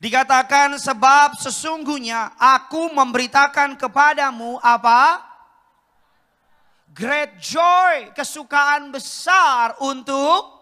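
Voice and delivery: loud, high proclaiming; ringing pulpit proclamation; preaching at full force. Acted. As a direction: call it out to the congregation.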